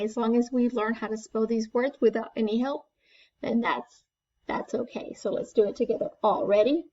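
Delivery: usual speaking voice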